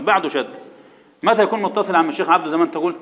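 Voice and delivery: usual speaking voice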